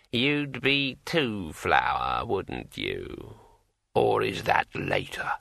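slow ancient voice sinking low at phrase ends; a dead old man, musing and unhurried; drawn-out old voice, dropping away; brittle old voice. A narrator's reading. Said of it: "in a brittle old voice".

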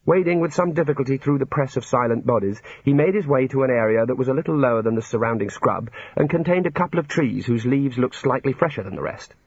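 ordinary delivery

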